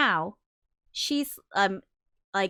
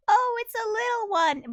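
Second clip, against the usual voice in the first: high, gushing mock coo; 4 dB louder than usual. Mocking how people fuss over a grown man, high gushing coo. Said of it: "be like".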